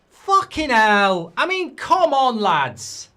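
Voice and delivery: loud, high furious yell at the players; angry outburst. Real, not acted. Fan yelling at his team after conceding.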